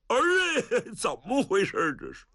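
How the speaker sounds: high anguished wail then fast question; crying out. Acted. Godfather sees his son tortured, wailing high. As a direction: cry out in pain for the son. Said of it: "老程一看疼哭了".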